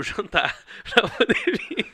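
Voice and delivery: laughing through the words; chuckling as he speaks. Real, not acted. Laughing while recounting skipping dinner.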